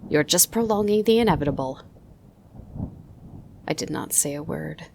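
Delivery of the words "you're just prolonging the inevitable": louder, quick, higher taunt; impatient opal miner. Acted. Opal miner taunting the boy in the circle, louder quick sneer. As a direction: hurry him along with a sneer. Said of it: "said the opal miner".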